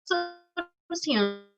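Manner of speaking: choppy robotic online audio; words breaking up over a bad call line; bad connection. Real, not acted. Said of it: "está muy robotizado su audio". (conexión entrecortada)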